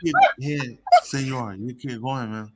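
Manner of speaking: mock-serious threatening character. Comic mimics the woman's supposed translation to tease her, mock-serious threat. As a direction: play a comic menacing homie. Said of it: "she was so serious. She said".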